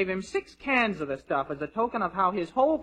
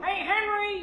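calling out; 9 semitones higher, slower than usual. Acted. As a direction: call across the room. Calling across the cafeteria.

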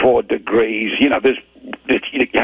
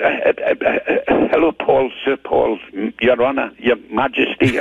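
re-enacting a starstruck stammer; tongue-tied. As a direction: stumble and grope for the right title. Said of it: "I was absolutely tongue-tied".

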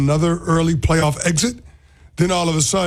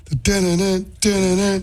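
sing-song phone alert jingle, pitched up; imitated alert sound; 4 semitones higher than usual. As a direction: mimic a breaking-news notification tone. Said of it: "the sound or the alert on my phone".

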